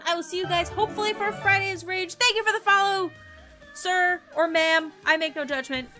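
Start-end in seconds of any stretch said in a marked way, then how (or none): none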